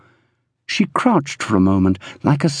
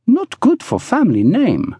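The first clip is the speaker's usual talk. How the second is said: high-pitched haughty objection; disdainful, high woman's voice; sniffy; 11 semitones higher than usual. A narrator's reading. Woman defending the family name, sniffy. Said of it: "she sniffed".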